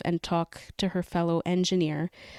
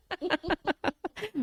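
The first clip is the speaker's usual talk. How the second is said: bright laugh; 12 semitones higher than usual. Real, not acted. Laughs after answer.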